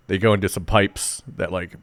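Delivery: ordinary delivery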